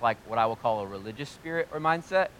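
ordinary talk, nothing marked

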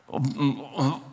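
weak, soft groan; muffled, miserable moan; imitated seasick groan. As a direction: groan as if queasy. Acting seasick under his hat.

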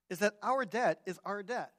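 usual speaking voice

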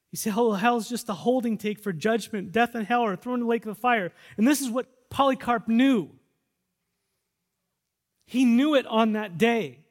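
loud, high, fervent sermon delivery; full-force preaching. Acted. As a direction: drive it home to the congregation.

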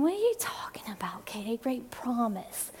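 hushed whisper of a puzzled listener; a listener whispering their confusion to Katie; whispered doubt. Retold or imitated. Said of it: "you might be saying".

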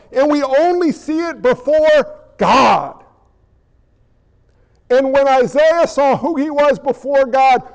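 ordinary delivery

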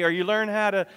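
ordinary talk

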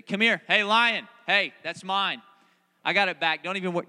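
loud calling out to a lion. Pretend. Preacher chasing a lion from the car, loud. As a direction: shout after the lion, then reassure. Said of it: "I'm like".

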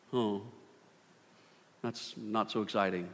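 ordinary talk

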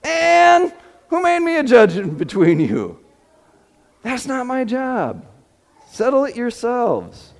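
dismissive inflection. Shown as a demonstration. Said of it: "I can just hear the inflection".

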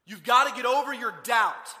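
loud, high scolding; stern demand. Acted. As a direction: order the doubter firmly, at full volume. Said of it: "He didn't walk in the room and say".

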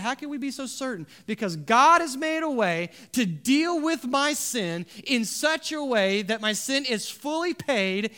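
high, forceful pulpit proclamation; impassioned preaching. Real, not acted. Preacher assuring the church of salvation, impassioned.

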